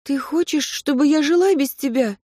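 a hint of a hurt young woman; Katya, a young woman. A narrator's reading.

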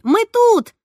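eager call to a classmate passing by. Acted. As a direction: shout so he hears you. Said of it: "обрадовалась я и замахала руками".

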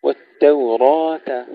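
والتوراة with a thin T and heavy R; light ta. Shown as a demonstration. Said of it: "ترقيق التاء". (ترقيق التاء)

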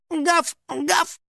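high, hesitant dog imitation; timid little yaps; unsure fake bark. A narrator's reading. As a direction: bark unconvincingly, pretending to be a dog. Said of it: "пролаял он неуверенно".

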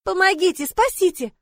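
high, loud panicked squeal; squealing for help. A narrator's reading. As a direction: squeal for rescue at a high pitch. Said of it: "И я заверещала". (визгливый крик о помощи)

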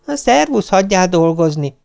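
high, curt goodbye; impatient brush-off. Retold or imitated. Wife brushes off husband to get back to work, high and curt. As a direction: cut him off impatiently.

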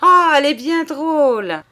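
loud, high burst of mirth; host laughing at the joke, loud and high; laughing loudly. A narrator's reading. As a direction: roar it out as if you can't stop laughing. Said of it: "se mit à rire de toute sa force".

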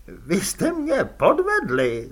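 high, slow moan on podvedli; moaning accusation. A narrator's reading. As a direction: moan it out, betrayed and whiny. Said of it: "zasténal Fredka".

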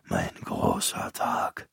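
faint, slow dying whisper. A narrator's reading. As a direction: breathe it out like a dying old man. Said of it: "flüsterte er". (geflüstert, langsam und kraftlos wie ein Sterbender)

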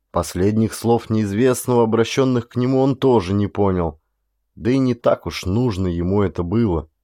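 ordinary delivery, nothing marked

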